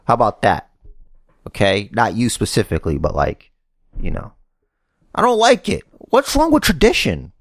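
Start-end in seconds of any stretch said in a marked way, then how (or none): 5.1-7.3 high-pitched indignant complaint; worked-up, plaintive objection; exasperated protest. Real, not acted. Ranting about tradition.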